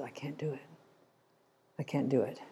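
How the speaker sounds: very soft and low, nearly under the breath; hushed, pained refusal. Retold or imitated. Shepherd refusing the researcher as the sheep cries, hushed and pained. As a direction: give in quietly, the words barely let out.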